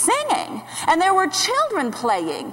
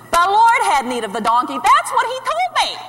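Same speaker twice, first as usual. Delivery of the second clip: loud, incredulous echo climbing very high; exasperated rise to a high yell; outraged repeating; 7 semitones higher, 5 dB louder than usual. Acted. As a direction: throw the excuse back, ending in a shriek. Mother outraged at son's excuse.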